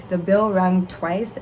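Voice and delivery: usual speaking voice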